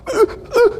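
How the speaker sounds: short high-pitched mock sob; brief squeaky catch of held-in crying; imitated stifled sob. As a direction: imitate a boy choking back tears. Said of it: "He's about to burst in tears".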